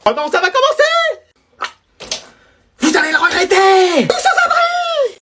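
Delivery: quavering high granny voice, slowed down; old granny voice, high and drawn out; high slow old lady. Acted.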